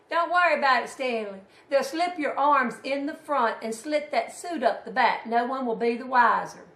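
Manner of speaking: imitated aunt's loud, brisk dismissal; shrugging it off. Aunt telling her husband not to worry, imitated loud brush-off. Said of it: "His beloved shrugged off that news".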